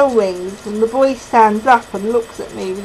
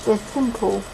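ordinary delivery

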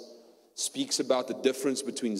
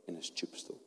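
hushed whisper of stillness; 4 semitones lower, 13 dB quieter than usual. Retold or imitated. Whispering to the church about a still night. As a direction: breathe it out, barely voiced. Quiet peace lying in dark bed.

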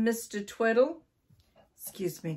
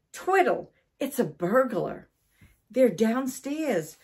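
urgent, higher wake-up cry; alarmed wife; 5 semitones higher, 4 dB louder than usual. A narrator's reading. Wife wakes husband about a burglar, urgent alarm. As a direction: raise the alarm, punching the name.

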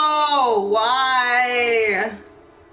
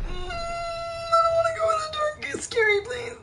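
whiny scared; 5 dB quieter than usual. Real, not acted. Afraid of the dark.

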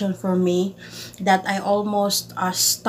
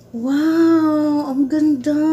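higher, louder happy wow; higher delighted praise; 6 semitones higher, 4 dB louder, much slower than usual. Real, not acted. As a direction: gush over how pretty it is. Admiring gift.

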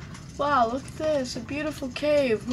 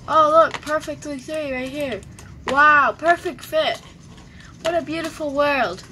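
hammed-up delight; 4 semitones higher, 6 dB louder than usual. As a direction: overplay the wonder. Exaggerated delight.

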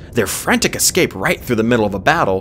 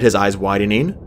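plain reading voice

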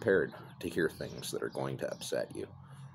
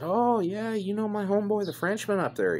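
mock buddy-buddy; 4 dB louder than usual. Mock-friendly reply to scammer.

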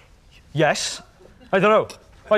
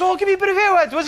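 high, fast eager plea; eager girl; 12 semitones higher, faster than usual. Retold or imitated. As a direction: beg playfully and quickly. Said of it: "And this other girl's like".

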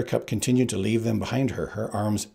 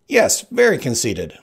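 higher, louder, smug agreement; Sicilian's voice; 4 semitones higher, 8 dB louder than usual. Acted. Sicilian answering Buttercup's thought, loud and smug. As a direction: lean into very, pleased.

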